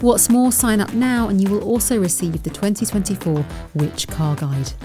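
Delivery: normal talking voice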